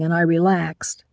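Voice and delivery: plain reading voice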